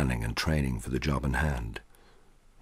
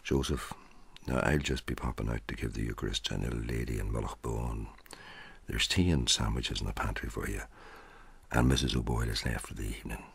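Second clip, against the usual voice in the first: hushed, much softer priest's voice; almost whispering; 4 semitones lower, 8 dB quieter than usual. Acted. Priest quietly instructs Joseph before slipping out, hushed. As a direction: keep it low, the walls have ears. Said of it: "almost whispering to add to the secrecy".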